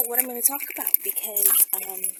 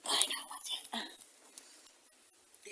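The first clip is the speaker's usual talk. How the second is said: whisper; 4 semitones lower, 7 dB quieter than usual. Real, not acted. Showing off her hat.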